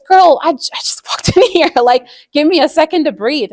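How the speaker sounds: exasperated husband. Retold or imitated. Tired husband just home from work begging his wife for a moment, exasperated. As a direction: plead for a second to breathe, exasperated.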